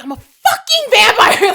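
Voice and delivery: high, loud, slow laughing outburst; cracking up while shouting it; laughing exasperation. Retold or imitated. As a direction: blurt it through laughter. Louis retold exasperated, laughing.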